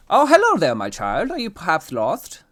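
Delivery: high, loud theatrical greeting; fancy gentleman. A narrator's reading. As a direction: greet a lost child with a flourish. Fancy man greets lost child.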